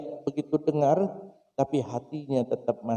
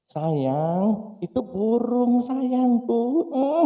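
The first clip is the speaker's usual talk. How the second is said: tender father; 9 semitones higher, much slower than usual. Acted. Father with own child, kissing.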